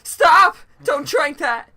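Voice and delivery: high, very loud cry of alarm; urgent shout. Acted. Character stopping someone about to drink, alarmed shout. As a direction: shout a warning at the top of your voice.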